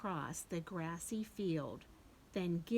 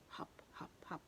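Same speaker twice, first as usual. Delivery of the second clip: soft unvoiced hop, hop; whispered hops; 2 semitones lower, 11 dB quieter, faster than usual. Shown as a demonstration. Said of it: "What's the bunny do?".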